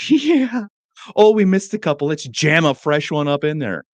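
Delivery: high, loud mock relish; gleeful enjoyment. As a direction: play someone enjoying the job far too much. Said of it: "like, really enjoys it".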